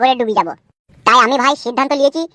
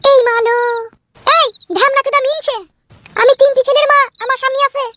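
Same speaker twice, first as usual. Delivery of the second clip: high-pitched, outraged woman character; indignant married woman; 12 semitones higher than usual. Acted. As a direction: rebuff the suitor in a high, scandalised voice. Skit, woman rebuffs the suitor. (চড়া মেয়েলি গলায় ক্ষুব্ধ প্রত্যাখ্যান)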